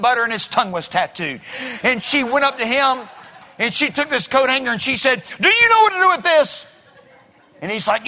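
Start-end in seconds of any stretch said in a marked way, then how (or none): 5.4-6.7 desperate plea. Retold or imitated. Desperate woman asks stranger.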